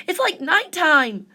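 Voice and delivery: mild cry of dismay; cried out. A narrator's reading. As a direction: call out in the dark. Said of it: "cried the sea urchin".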